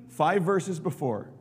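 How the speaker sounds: ordinary talk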